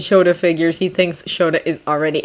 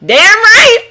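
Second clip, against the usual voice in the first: cheerful husband voice; 14 semitones higher, 7 dB louder than usual. A narrator's reading. Hisashi's voice.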